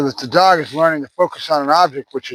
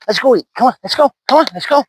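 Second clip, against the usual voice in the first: high, loud, eager come-on; rising excitement, calling him along; excited call to the dog; 8 semitones higher, 3 dB louder than usual. Real, not acted. Trainer calling the puppy to breakfast, eager and high.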